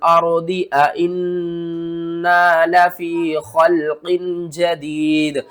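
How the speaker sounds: slow, drawn-out melodic tilawat; Quran recitation. Shown as a demonstration. Teacher recites the full verse for students. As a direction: recite the verse slowly and lingeringly. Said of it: "किस तरह?".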